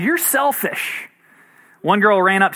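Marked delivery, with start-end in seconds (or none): 0.0-1.1 high, drawn-out mock kid accusation; lightly hammed-up little-kid reproach; accusing kid. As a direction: imitate a put-out child, pitched up and slow. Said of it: "Another kid replied".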